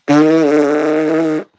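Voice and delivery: loud honking fake nose blow; mock nose blow. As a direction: imitate husband's big nose blow. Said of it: "That's how I make fun of my husband".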